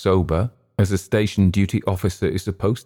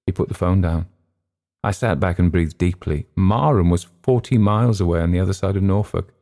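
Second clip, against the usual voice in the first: ordinary delivery, nothing marked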